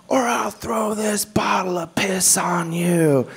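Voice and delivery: slow whispered threat; menacing whisper. Retold or imitated. Homeless man threatens comedian, slow whispered menace. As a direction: hiss the threat slowly.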